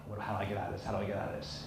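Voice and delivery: hushed thinking to oneself; very soft, low, as if only in your head; inner thoughts. Acted. Clerk thinking how to escape an angry customer, hushed inner thoughts. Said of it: "And he's thinking".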